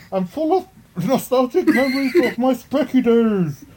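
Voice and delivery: hammed-up Bane voice; Bane impression. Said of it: "I'll read mine in the voice of Bane".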